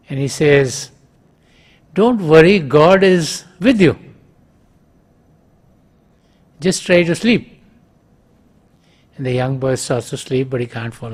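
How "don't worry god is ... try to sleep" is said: higher, louder call of comfort; shouting back. Retold or imitated. Father shouts back to the boy, higher and louder. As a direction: shout reassurance through the wall. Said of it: "shouts back".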